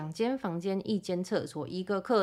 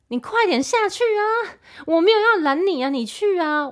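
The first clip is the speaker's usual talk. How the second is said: exasperated; 12 semitones higher, 6 dB louder than usual. Retold or imitated. Exasperated thoughts at impatient dad.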